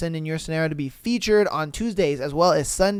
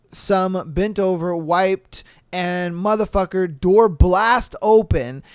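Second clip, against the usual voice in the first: ordinary talk, nothing marked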